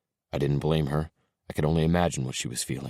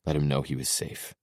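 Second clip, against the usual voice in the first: ordinary delivery, nothing marked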